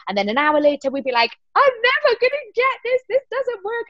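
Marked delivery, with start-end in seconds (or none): 1.5-3.9 very high, hammed-up despair; comic squeaky-high meltdown; mock panic. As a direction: wail it like an overwrought actor in rehearsal. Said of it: "panicking about it".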